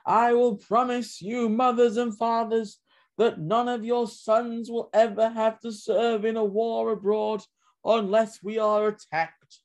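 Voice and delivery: high, slow, declaiming campaign orator; FDR. Acted. Presidential candidate promising mothers and fathers their sons' safety, slow declaiming. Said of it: "it was famous in the forty election. He said".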